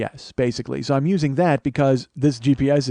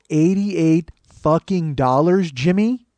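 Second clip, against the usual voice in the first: slow, drawn-out outrage; slow incredulous challenge to the clerk; incredulous protest; 3 semitones higher, 4 dB louder, much slower than usual. Retold or imitated. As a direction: drag out each word in disbelief. Protesting the parking charge to clerk.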